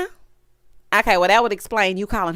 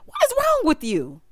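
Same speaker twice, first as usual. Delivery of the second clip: high-pitched mock disbelief; exasperated question pitched way up; 11 semitones higher than usual. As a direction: challenge them in a high, baffled voice. Reacts to the imitated hollering.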